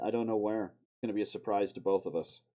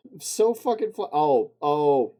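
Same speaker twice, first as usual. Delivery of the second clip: giddy excitement; 5 semitones higher, 12 dB louder, slower than usual. Real, not acted. Overjoyed at the edit.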